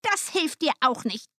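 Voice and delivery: high, dismissive witch voice; scolding old witch. A narrator's reading. Witch to the lamenting girl, scolding. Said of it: "sagte die Alte".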